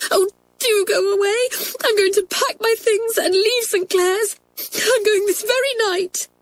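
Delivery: high, quick tearful outburst; sobbing. Acted. Crying girl vows to leave school, sobbing. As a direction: wail it in despair, voice pitched right up. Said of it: "sobbed Kathleen".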